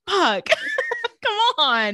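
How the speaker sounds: loud, high cry of dismay; mock exasperation. [name] sees his mom getting drunk, loudly exasperated.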